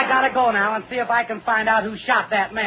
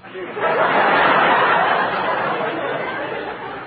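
high breathy laugh; 9 semitones higher than usual. Real, not acted. Laugh after joke.

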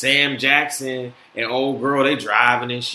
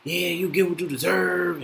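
retold taunt; 3 semitones higher than usual. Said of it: "she was talking some shit".

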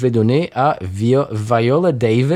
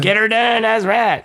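Larry the Cable Guy; 9 semitones higher, slower than usual. Acted. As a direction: put on a high Southern US comedian's twang. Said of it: "Larry the Cable Guy".

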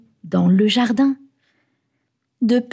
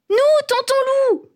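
put-on very high, eager kids' call; excited children. A narrator's reading. Children answer their uncle who asks who sleeps outside, excited. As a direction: sing out like thrilled children.

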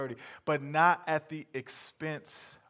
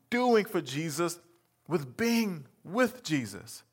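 ordinary talk, nothing marked

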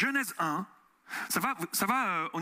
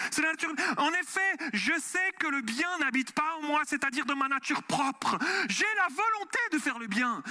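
fervent sermon delivery, pitched up; preaching voice; 5 semitones higher than usual. Acted. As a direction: preach it to the congregation with conviction. Pasteur prêche devant l'assemblée. (voix de prédicateur fervente)

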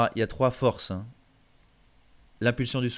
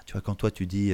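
still whispering; 2 semitones lower than usual. Shown as a demonstration. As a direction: keep it breathed, no voice. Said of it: "une voix comme ça". (chuchoté)

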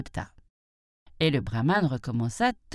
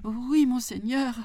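high, meek mock fright; timid jackal; 9 semitones higher than usual. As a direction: answer meekly in a high voice. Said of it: "faisant semblant de trembler de peur". (chacal craintif, voix aiguë)

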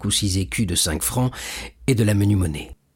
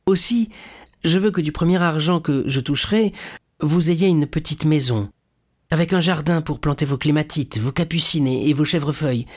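lightly voiced eager son, higher at first; affectionate young son; 6 semitones higher than usual. Son promising his father a little house, affectionately. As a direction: share a happy plan, pitch easing down.

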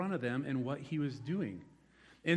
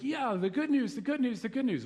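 mock eager cheer; 8 semitones higher, 4 dB louder than usual. Mocking eager churchgoers.

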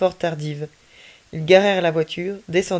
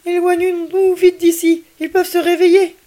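much higher, louder, slow frightened plea; a scared boy urging his friend to run from the frozen police; urgent child; 12 semitones higher, 8 dB louder than usual. Acted. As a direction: beg to flee before they wake. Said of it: "en essayant de ne pas parler trop fort".

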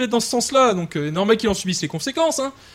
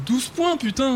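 relishing the score; 3 semitones higher, slower than usual. Acted. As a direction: savour it under your breath. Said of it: "t'as vu ce coup que je leur ai mis".

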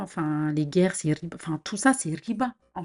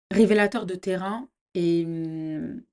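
ordinary talk

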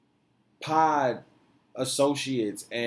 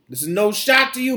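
ordinary talk

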